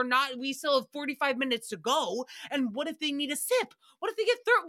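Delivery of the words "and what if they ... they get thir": mock panic peak. Worrying aloud that the guests will get thirsty, panicked squeak.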